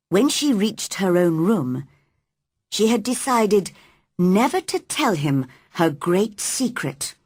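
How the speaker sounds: ordinary delivery, nothing marked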